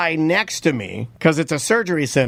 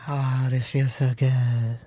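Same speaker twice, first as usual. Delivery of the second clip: drugged bliss; 5 semitones lower, 6 dB quieter, much slower than usual. Retold or imitated. Drugged, dreamy after surgery.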